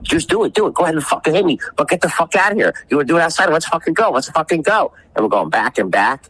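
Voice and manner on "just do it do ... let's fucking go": daring him. Retold or imitated. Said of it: "I'm like".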